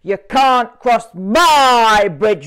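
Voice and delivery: loud, slow roaring refusal; roaring troll. A narrator's reading. Troll roaring at the big goat, slow and loud. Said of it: "roared the Troll".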